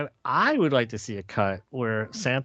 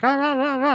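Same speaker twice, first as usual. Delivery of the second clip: put-on kids' high chant; 5 dB louder than usual. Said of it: "It's like the Charlie Brown".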